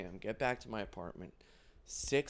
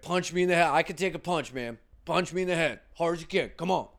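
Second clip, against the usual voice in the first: put-on loud, higher drunk bro; drunk bro shout; 5 semitones higher, 7 dB louder than usual. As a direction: bellow it like a drunk tough guy. Voices drunk dudes.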